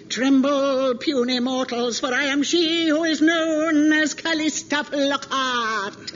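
slow, booming, menacing dragon proclamation. Acted. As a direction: threaten mortals like a mighty dragon. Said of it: "you can do any name in the voice".

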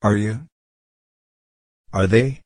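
usual speaking voice

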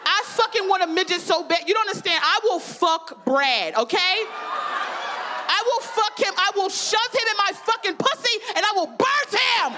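high-pitched, hammed-up desperate vow; comic outburst, pitched high with drawn-out emphatic words; desperate ranting. Comedian confessing an absurd craving to the ladies, desperate ranting.

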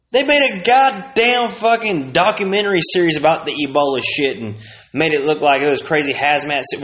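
normal talking voice

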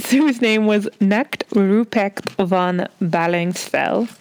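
slow, hammed-up German accent on the name; attempted German accent. Podcast host reading a legend's name, mock German accent. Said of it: "You want to do an accent".

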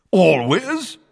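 loud, high booming question. A narrator's reading. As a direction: boom out the word. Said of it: "boomed Madam Bones".

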